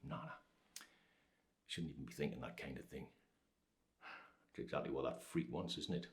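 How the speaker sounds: low, hushed and withdrawn; subdued self-reproach. Acted. Father pushing away thoughts about his son, low and quiet. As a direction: drop right down, as if talking yourself out of it.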